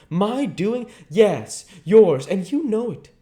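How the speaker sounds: loud indignant reply; indignant retort. A narrator's reading. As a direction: fling the blame back. Lord Henry is accused, retorts.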